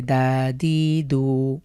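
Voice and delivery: slow, stretched দ্যাদিদু with long vowels; wrong drawn-out reading. Shown as a demonstration. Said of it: "না, এভাবে পড়া যাবে না".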